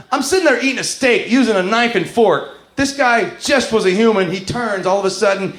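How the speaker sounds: ordinary talk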